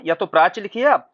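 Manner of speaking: ordinary talk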